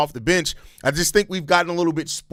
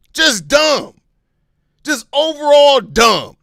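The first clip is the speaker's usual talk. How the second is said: loud, high, exasperated outbursts; real heated yelling; angry shouting; 7 semitones higher, 7 dB louder than usual. Real, not acted. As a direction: blast the complainers, completely fed up. Ranting angrily at complaining fans.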